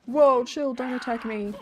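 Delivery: loud startled whoa. Real, not acted. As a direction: jump back, startled. Startled by attacking guard.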